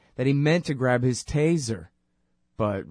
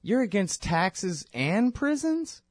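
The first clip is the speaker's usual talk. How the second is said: put-on confused kid; 7 semitones higher, slower than usual. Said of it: "this kid came up to him and he said".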